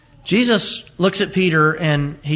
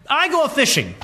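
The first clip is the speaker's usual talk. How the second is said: put-on lifted high, old-fashioned declaration; Peter's solemn words; 9 semitones higher than usual. Preacher voicing Peter's resolve to disciples, lightly raised declaration. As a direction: announce it like a fisherman going back to his trade. Peter's quote, set against ours.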